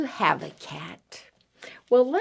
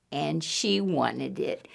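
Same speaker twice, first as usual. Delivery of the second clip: usual speaking voice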